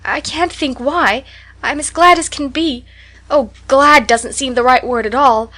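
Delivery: put-on tearful happy child; excited joy. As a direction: spill over with happiness. Anne voiced, tearful joy.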